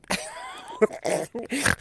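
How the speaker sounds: shrieking high laugh; high burst of laughter. Real, not acted. Laughing at the TV show with her cohost. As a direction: let the laugh fly up high.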